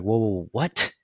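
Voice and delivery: lightly voiced baffled listeners; a hint of taken-aback confusion; mock startled reaction. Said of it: "everyone would be like".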